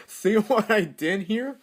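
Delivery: high, loud question through giggles; delighted, laughing as you show it off. Real, not acted.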